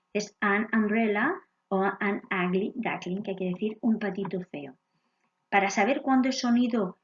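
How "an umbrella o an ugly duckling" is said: "an umbrella" and "an ugly duckling" said with an open vowel sound, not "you"; English pronunciation. Shown as a demonstration. Teacher modelling "an umbrella" and "an ugly duckling" for students. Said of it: "así es como se pronuncia como A".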